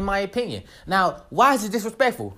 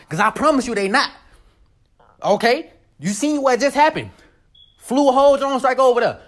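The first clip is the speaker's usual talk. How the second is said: normal talking voice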